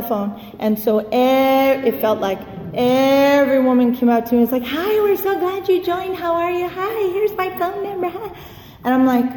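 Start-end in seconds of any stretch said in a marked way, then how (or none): 4.7-8.3 higher, slow sugary greeting; gushing welcome. Retold or imitated. Newcomer mimics women at the meeting, sugary and high. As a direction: coo it like an overeager church lady.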